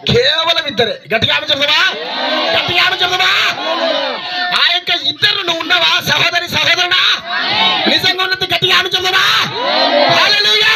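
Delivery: high, impassioned pulpit shout; full-force preaching. Acted. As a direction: drive it up to a hallelujah cry.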